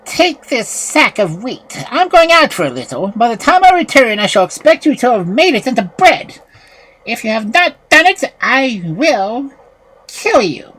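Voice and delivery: high, loud witch giving orders; threatening witch. A narrator's reading. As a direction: order the girl about, then threaten her. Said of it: "called the girl to her and said".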